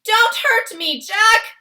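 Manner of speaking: high, loud frightened plea; yelling. A narrator's reading. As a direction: yell like a giant begging for mercy. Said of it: "he yelled".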